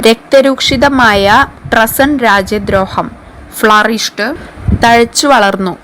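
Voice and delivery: ordinary talk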